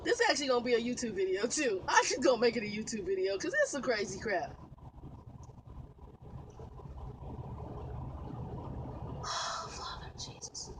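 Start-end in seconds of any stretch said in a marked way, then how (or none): 4.6-9.2 long, soft exhale; long weary sigh. Real, not acted. Exasperated trucker pausing her story, long sigh. 9.2-10.7 slow whispered prayer; whispered plea. Real, not acted. Exasperated trucker calling on Jesus, whispering slowly.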